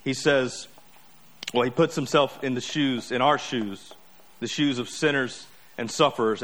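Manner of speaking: usual speaking voice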